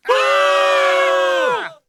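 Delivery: loud, very high collective scream; long shared shriek of fright; everyone screaming. A narrator's reading. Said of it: "all of them were screaming".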